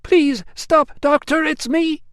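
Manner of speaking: very high, loud female call. Acted. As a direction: call across to stop them. Emilia calls across hall.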